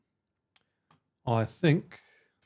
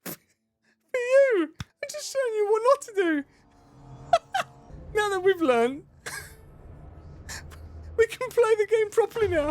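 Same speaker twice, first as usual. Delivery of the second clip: squeaky high voice. Pretend. High silly voice tutorial.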